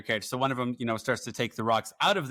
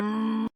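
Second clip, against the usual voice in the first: high-pitched "mm" noise standing in for a curse; wordless grumble; 10 semitones higher than usual. Shown as a demonstration. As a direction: make a weird noise instead of swearing. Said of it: "I do sound effects".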